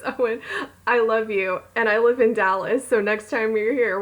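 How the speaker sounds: ordinary delivery, nothing marked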